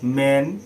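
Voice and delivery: ম্যান said whole in one go; one single syllable. Shown as a demonstration. Teacher showing a one-syllable word, ম্যান in one go. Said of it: "পুরোটা আমরা একবারে উচ্চারণ করে নিচ্ছি".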